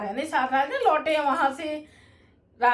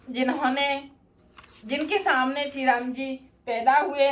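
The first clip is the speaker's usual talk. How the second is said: ordinary delivery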